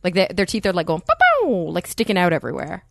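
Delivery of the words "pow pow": high, loud cartoon pow pow; pow pow sound effect. Cohost mimicking crooked teeth with a high, loud pow pow.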